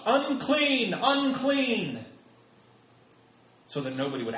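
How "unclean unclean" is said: high, drawn-out warning cry; calling out. Acted. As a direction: call it out high and loud to warn people off. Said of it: "they had to call out".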